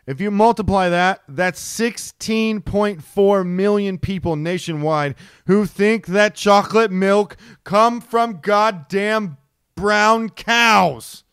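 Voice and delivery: reading the stats slowly and hotly; pissed off. Real, not acted. Host reading dairy survey stats to co-host, seething and slow. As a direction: let the anger push each number out. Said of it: "You sound like, when you read that out, the tongue twister".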